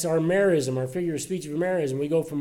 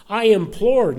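"implored" hit high and loud; stress on implored; 5 semitones higher, 8 dB louder, slower than usual. Shown as a demonstration. Preacher reading Paul's plea, "implored" pressed high and loud. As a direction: lean hard into "implored", not just prayed. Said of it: "not just prayed, not just asked".

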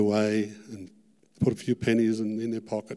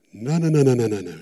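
loud refusal; 7 dB louder, faster than usual. Retold or imitated. Refusing God's call again.

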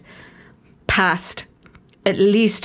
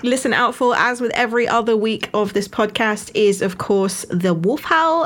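normal talking voice

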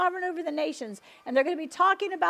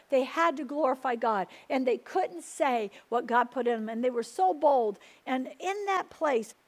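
ordinary talk, nothing marked

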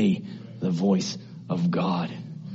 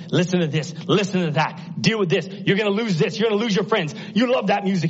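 high, insistent run of short phrases; higher-pitched, driving pulpit delivery; urgent preaching; 9 semitones higher than usual. Acted. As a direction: preach it as a piled-up list of nagging demands. Preacher rattles off Satan's distractions.

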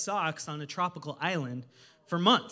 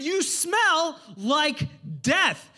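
high, very slow mocking pronouncement; hammed-up hotel clerk; clerk's verdict; 9 semitones higher, 6 dB louder, much slower than usual. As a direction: drawl out the insult. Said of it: "They said".

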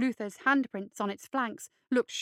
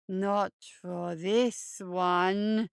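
low, slow, creepy hare voice; low creeping monster croon; hulking hare creature; 3 semitones lower, much slower than usual. Acted. Luther refuses.

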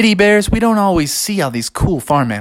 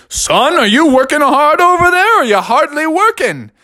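dad shouting; 11 semitones higher, 4 dB louder than usual. Acted. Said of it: "Bubby's dad shouted out".

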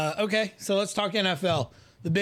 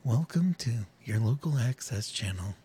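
low local access host voice; lightly voiced local channel host; local TV announcer; 7 semitones lower, faster than usual. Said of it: "that's how we should be doing this".

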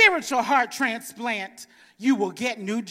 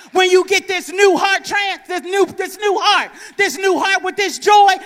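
loud, high preacher's climb; full-force preaching; 5 semitones higher, 10 dB louder than usual. Acted. Preacher rousing the congregation, shouting high and loud.

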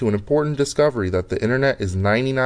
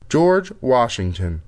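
slow and clear; 4 semitones lower, slower than usual. Acted. Repeating to voice system.

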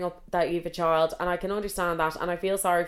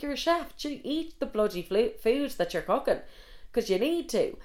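higher-pitched, mock exasperated questioning; retold disbelief, pitched up; incredulous rant; 6 semitones higher than usual. Telling listeners her reaction to the skinny chef, incredulous.

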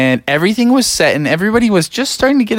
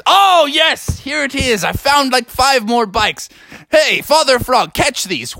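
higher, pleased calling out; excited find; 7 semitones higher than usual. Acted. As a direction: shout down from the rafters with glee. Father Beaver calls from rafters.

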